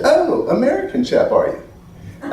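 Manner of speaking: high, posh-sounding British drawl, trailing off into a near whisper; mock British accent. South African man sizing up the American visitor, mock British accent.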